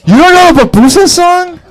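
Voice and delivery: loud, very high disbelief; incredulous. Real, not acted. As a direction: exclaim in astonishment at his ignorance. Astonished at audience member's ignorance.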